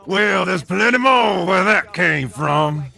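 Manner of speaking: gleeful villain shout; taunting shout. Acted. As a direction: crow it at the enemy. Villain taunting after fight.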